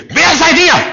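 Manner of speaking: sudden very high, loud shriek; hammed-up possessed scream; possessed man. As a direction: explode into a demon's challenge. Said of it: "steht der Besessene auf und sagt".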